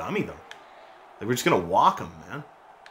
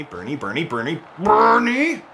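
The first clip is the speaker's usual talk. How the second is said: alarmed call; 4 semitones higher than usual. Real, not acted. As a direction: call out to a hurt teammate. Calling player after hit.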